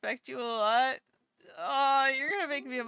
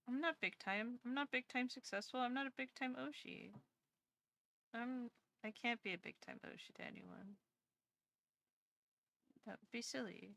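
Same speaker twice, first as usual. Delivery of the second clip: much softer, trailing down to a murmur; fading self-deprecation; 16 dB quieter than usual. Real, not acted. Streamer downplaying her own success to chat, fading murmur. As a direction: talk yourself small, quieter each line.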